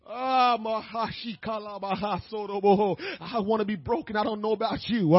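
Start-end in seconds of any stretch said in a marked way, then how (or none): 0.1-3.0 speaking in tongues. Real, not acted. Speaking in tongues mid-sermon.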